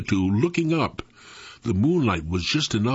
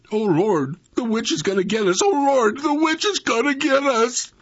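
high-pitched panicked wail, climbing higher and louder; hysterical; 13 semitones higher, 3 dB louder than usual. A narrator's reading. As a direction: build from alarm into acted hysteria. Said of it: "Dewey Lee was hysterical".

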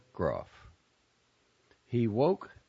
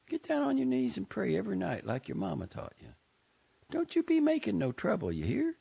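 higher, motherly Southern aunt; fond aunt's farewell; 13 semitones higher than usual. A narrator's reading. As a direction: send him off, pitched up and fond. Aunt Maisie voiced by reader.

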